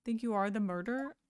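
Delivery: ordinary talk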